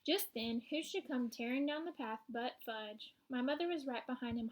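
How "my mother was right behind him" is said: usual speaking voice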